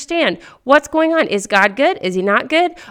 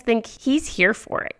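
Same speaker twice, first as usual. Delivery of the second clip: ordinary delivery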